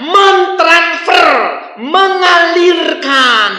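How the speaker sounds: slow, loud drawn-out preaching; preaching emphasis. Acted. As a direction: stretch each word with fervor. Preacher stretches key words. (berkhotbah lambat dan keras)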